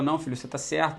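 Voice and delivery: usual speaking voice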